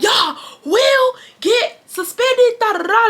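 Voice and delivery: slow, very high mock yell; imitated yelling. Advisor threatening both students with suspension, mock yelling. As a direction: stretch each word out in a high shout. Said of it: "he yells and he's like".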